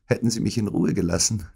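plain reading voice

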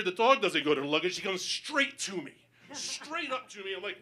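normal talking voice

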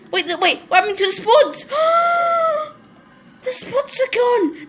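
hammed-up wail of dismay; mock horror. Gamer finds the spuds gone, mock horror.

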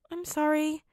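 almost whimpering sorry; near whimper. A narrator's reading. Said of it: "Peter almost whimpered".

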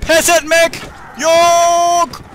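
very slow, high, loud stretched war cry; drawn-out war cry. Pretend. Commander on his last stand, slow stretched war cry. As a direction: hold every syllable like a last stand. Refusing to give up.

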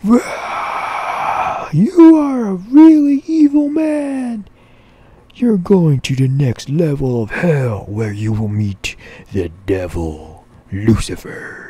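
very high, very loud squealing demon voice; shrieking demon. Acted. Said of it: "And the demon says".

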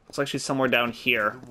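ordinary delivery

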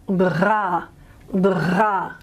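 uvular trill on bra, back of throat; throaty r. Shown as a demonstration. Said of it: "for the uvular trill".

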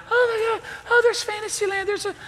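high squealy delight at the sights; giddy sightseeing. Retold or imitated. Granddad riding the monorail, squealing with glee. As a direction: gush like a thrilled kid on a ride.

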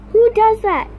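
loud, high, fed-up who does that; indignant cry at the camera; exasperated outburst. Real, not acted. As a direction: blurt it out in disbelief. Annoyed at getting an eraser.

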